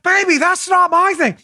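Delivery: high-pitched comic denial; mock-indignant and high; Austin Powers. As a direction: protest in a silly, high put-on voice. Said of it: "He's like".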